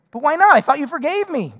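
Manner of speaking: mock-offended borrower; whiny protest. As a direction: act wounded at being refused. Debtor protests the refusal.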